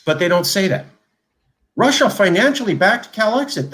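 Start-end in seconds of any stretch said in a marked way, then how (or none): 1.7-3.7 much higher, sarcastic headline read; mocking. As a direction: parody a breathless news claim.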